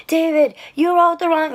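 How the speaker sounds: high, loud mock yelling into a phone; imitated freaking out. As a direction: mimic a passenger ranting at his friend. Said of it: "he's freaking out".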